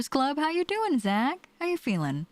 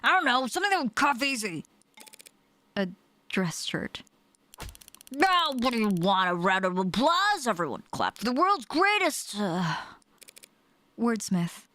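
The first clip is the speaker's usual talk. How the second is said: lightly voiced mocking character; sarcastic character; 4 semitones higher than usual. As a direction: sneer as you mock the wordsmith.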